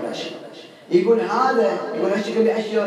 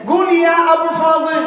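much higher, louder call to repeat; loud prompting call; 12 semitones higher, 6 dB louder than usual. Retold or imitated. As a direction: urge him to say the name. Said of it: "خلينا نختبره مرة ثانية". (نداء عالٍ يحثّه على القول)

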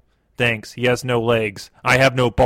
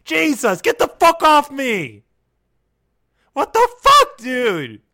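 scared and angry; 13 semitones higher than usual. Acted. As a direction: shriek in disgusted alarm. Balls put on his chest.

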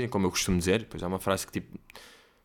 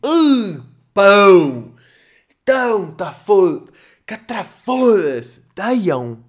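loud, high, strangled cry of disgust; over-the-top pained yell; exaggerated mock outrage; 8 semitones higher, 10 dB louder, much slower than usual. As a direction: wail as if physically hurt by the word. Mocking the wrong word deiam.

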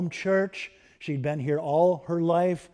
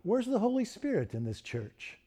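softer, higher, searching question; wondering to herself; 5 semitones higher, 6 dB quieter than usual. Retold or imitated. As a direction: wonder it quietly, half to yourself. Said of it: "she would wonder to herself".

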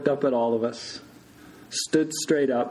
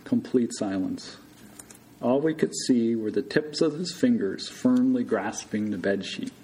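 ordinary delivery, nothing marked

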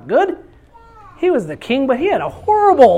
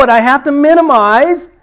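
loud; 6 semitones higher, 8 dB louder than usual. Real, not acted. Preacher builds to loud peak.

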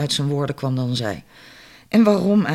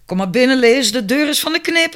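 higher, louder call to the door; calling back; 8 semitones higher, 4 dB louder than usual. A narrator's reading. Mary calls back to her neighbour at the door, raised and high. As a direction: call out without getting up. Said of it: "riep ze terug zonder op te staan".

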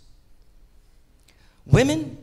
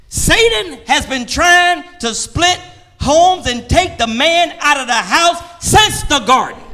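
raised preacher's call; preaching; 9 semitones higher, 5 dB louder than usual. Acted. Preacher calling out to the congregation, raised.

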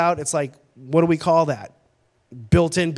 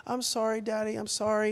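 soft imitation of a sorry child; apologetic little girl; 2 semitones higher, 8 dB quieter than usual. Young daughter apologising to her dad, softly imitated. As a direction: apologise in a small, quiet voice.

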